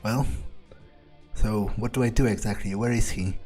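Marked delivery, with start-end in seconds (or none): none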